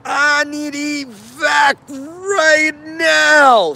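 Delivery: a slow, high, desperate yell for help. Retold or imitated. Wounded man yelling for evacuation, desperate shout. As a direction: cry it out like a wounded man begging for evac. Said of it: "there's a guy who yells".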